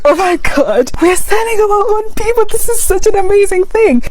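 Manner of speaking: high, mock-thrilled gushing; mocking gushing American. Mocking an American who gushes about heritage, high excited voice.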